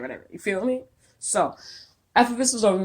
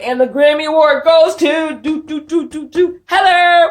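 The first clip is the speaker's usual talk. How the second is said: mock award announcer; 9 semitones higher, 11 dB louder than usual. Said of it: "putting on a freaking show".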